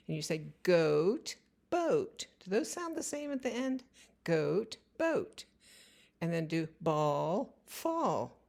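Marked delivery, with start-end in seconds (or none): 0.6-5.4 boat leaps up high after goat; rhyme pair. Shown as a demonstration. Parent asking a child if words rhyme, boat pitched high. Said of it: "Do they rhyme".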